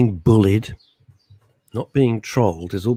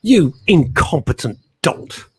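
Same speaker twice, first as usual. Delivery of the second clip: slow, louder hammed-up villain; mock villain scold; 6 semitones higher, 5 dB louder, slower than usual. Scolding co-host over missing jingles like a hammy villain. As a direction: sneer it like a cartoon overlord.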